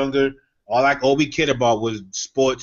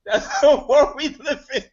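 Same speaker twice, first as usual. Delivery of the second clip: high, drawn-out, laughing repeat; singled-out line, slow and amused; amused mock echo; 11 semitones higher, 3 dB louder than usual. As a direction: echo it slowly through a laugh, pitched way up. Mockingly repeats friend's answer, laughing.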